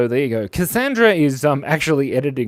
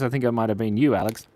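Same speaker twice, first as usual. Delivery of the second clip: ordinary talk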